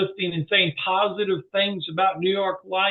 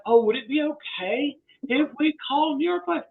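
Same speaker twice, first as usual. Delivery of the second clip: timid asking; 8 semitones higher than usual. Retold or imitated. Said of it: "I don't say".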